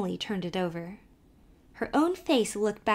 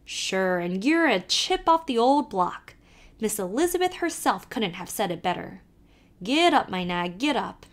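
put-on lively Irish accent, calling to the horse; old Irish driver calling out; Irish carter; 3 dB louder than usual. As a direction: urge the nag on in a brogue. Kelly teases Emily.

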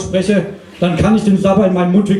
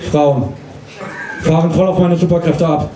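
ordinary talk, nothing marked

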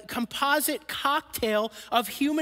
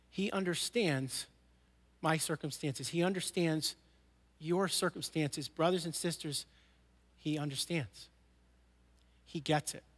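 softer, lower, intimate preaching; quiet heartfelt pastoral close; tender closing appeal; 7 semitones lower, 7 dB quieter than usual. Acted. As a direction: draw them in gently.

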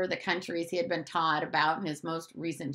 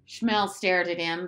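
plain reading voice

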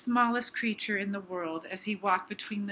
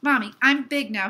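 put-on higher, much louder storybook child's cry; excited cry; 4 semitones higher, 9 dB louder than usual. A narrator's reading. Little Hippo tells his mommy he is big, excited cry. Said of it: "he cried".

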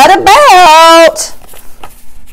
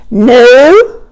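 ordinary delivery

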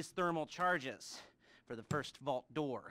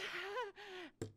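high giggle; 5 dB quieter than usual. Real, not acted. Laugh after meds joke.

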